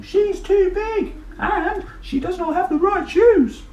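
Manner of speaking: very high, slow, loud taunt; mocking girls. Girls mock the dinosaur in high snooty voices. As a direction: sneer like snooty little girls. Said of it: "They're saying".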